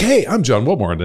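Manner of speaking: hammed-up radio host intro, punchy HEY; mock announcer greeting; old radio ad voice. Shown as a demonstration. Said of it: "it's almost like I'm doing old radio ads".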